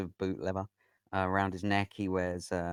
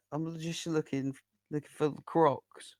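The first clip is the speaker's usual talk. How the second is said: high-pitched, insistent goblin voice; twitchy little goblin; 9 semitones higher, 4 dB louder than usual. Acted. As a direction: beg for it as a nervous goblin. Nervous goblin asks for Crocs.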